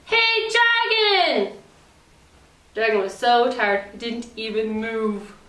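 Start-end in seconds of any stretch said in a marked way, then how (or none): 0.1-1.6 high, slow drawn-out yell; loud shout. A narrator's reading. Princess shouting into the dragon's ear. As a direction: yell right into his ear. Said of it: "shouted as loud as she could".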